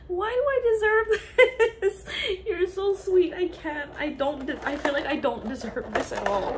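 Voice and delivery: real crying through grateful words. Real, not acted. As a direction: burst into overwhelmed tears, then ease off. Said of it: "I'm done crying".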